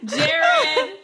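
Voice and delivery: high, loud call to another room. Real, not acted. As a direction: call him in from next door. Calling husband into room.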